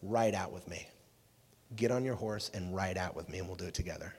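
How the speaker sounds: low, soft, lightly acted rallying call; Aragorn. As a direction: quote a quiet invitation to ride into battle. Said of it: "here's Aragorn's quote".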